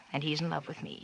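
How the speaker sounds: breathy whisper, barely voiced; whispered confession. [name] whispers her love for Dick to Arthur, hushed. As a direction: let it slip out as a secret whisper.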